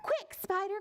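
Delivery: high, urgent storybook call; high-pitched acted urgency. A narrator's reading. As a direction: call out to rally a friend. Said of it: "called Caterpillar".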